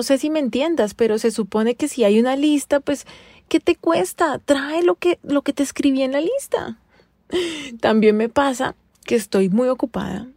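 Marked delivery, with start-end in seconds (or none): 3.5-6.8 high, pleading exasperation; why is this so hard, up high; fed-up plea pitched well above her usual voice; exasperated. Retold or imitated. Exasperated demand to husband.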